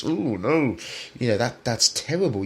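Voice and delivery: high, sarcastic gasp of disapproval; mock horror. Sarcastically voicing outraged critics of Kevin.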